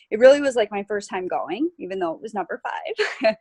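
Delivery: ordinary talk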